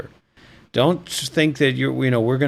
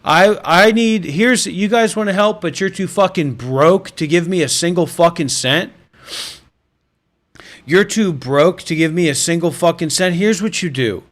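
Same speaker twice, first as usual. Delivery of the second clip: higher, louder, half-joking tirade; mock furious rant; 3 semitones higher, 3 dB louder than usual. Streamer yells at broke viewers, higher and louder, mock fury. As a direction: berate the viewers, building to a shout.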